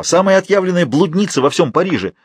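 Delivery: high-pitched outcry; crying out. A narrator's reading. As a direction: exclaim it as a heated accusation. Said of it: "вскричал Фалуа". (высокий возмущённый возглас)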